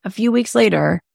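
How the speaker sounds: usual speaking voice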